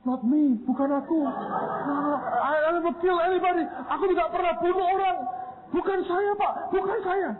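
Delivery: high, pleading student near tears; panicked denial. Retold or imitated. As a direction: beg that it wasn't you. (suara mahasiswa panik memohon, hampir menangis)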